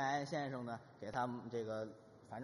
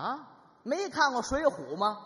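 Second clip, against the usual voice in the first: louder, higher hammed-up scolding; mock scolding; 6 semitones higher, 8 dB louder than usual. Husband lecturing his wife about Water Margin, mock scolding. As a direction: scold her with disbelief.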